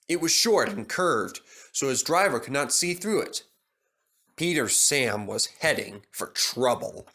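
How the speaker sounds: usual speaking voice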